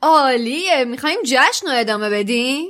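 loud, high, gleeful call; Fred cheering the party on; cheerful. A narrator's reading. Fred glad the party goes on, cheerful shout. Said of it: "با سرخوشی گفت".

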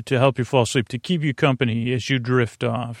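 ordinary talk, nothing marked

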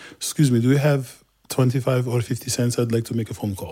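careful English with a Turkish accent; newcomer's Turkish-accented English, politely asking. Shown as a demonstration. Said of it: "what was an accented English".

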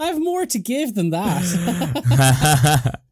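laughing. Acted. Mock-offended reply with laugh.

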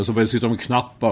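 ordinary talk

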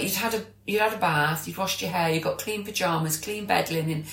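ordinary talk

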